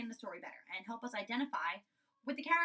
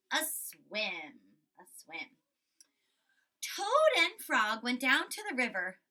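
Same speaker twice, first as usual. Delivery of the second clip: louder, lively read-aloud voice; reading to children; 6 dB louder, slower than usual. Acted. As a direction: read it out to the class with character. Reads story aloud to class.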